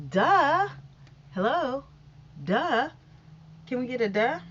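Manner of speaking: loud, mocking duh at the obvious news; sarcastic duh. Real, not acted. Scoffing at the headline about vaccines helping, loud and sarcastic. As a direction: call it out like everyone should already know.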